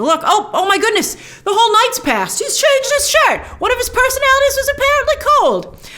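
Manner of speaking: high, laughing surprise, slowing down; surprised delight. Real, not acted. Spotting that a whole night passed, laughing surprise. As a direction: gasp into it, amused and amazed. Reacting to time jump in trailer.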